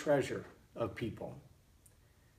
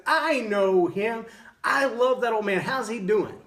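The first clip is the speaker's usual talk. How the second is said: high, loud, slow and beaming; delighted outburst; 8 semitones higher, 6 dB louder than usual. Retold or imitated. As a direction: gush with delight over an old friend. Old man delighted at grandpa's name.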